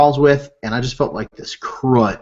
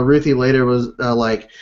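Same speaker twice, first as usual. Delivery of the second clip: usual speaking voice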